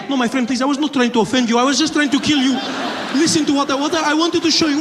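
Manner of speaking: put-on high accented pleading protest; placating bomber. Would-be bomber appeasing offended passenger, placating accented voice. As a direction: backpedal in a put-on Middle Eastern accent.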